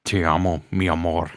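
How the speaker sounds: low Scottish brogue in Spanish; Sean Connery accent. Retold or imitated. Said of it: "Spanish Sean Connery".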